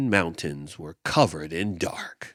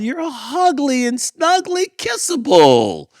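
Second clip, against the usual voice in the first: high, louder cheerful call from the crowd; 7 dB louder than usual. Acted. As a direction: call out lovingly to cheer him up. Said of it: "called the crowd".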